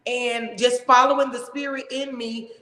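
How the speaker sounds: usual speaking voice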